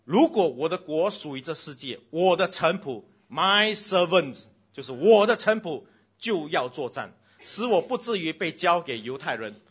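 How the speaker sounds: booming sermon reading; preaching at full force. Acted. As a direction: proclaim it to the whole hall. Reads Jesus' words with force.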